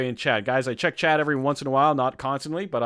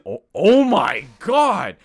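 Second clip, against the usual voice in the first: loud, high, stretched-out cry of delight; huge drawn-out excited exclamation; ecstatic outburst; 6 semitones higher, 10 dB louder, much slower than usual. Real, not acted. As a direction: shout it slowly in food rapture. Sees brownies in the list.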